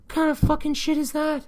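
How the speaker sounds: very high, disbelieving outburst; a shrill rhetorical cry of disbelief; incredulous squeal. Real, not acted. Outraged remark about shooting someone.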